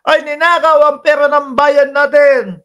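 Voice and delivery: a hint of a rally speech, mocked. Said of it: "ang sasabihin".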